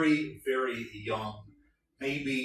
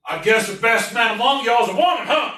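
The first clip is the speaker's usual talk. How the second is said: sergeant; 10 semitones higher, 8 dB louder than usual. Acted. Sergeant teasing the men about the woman, loud. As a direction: tease the recruits. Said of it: "the sergeant's like".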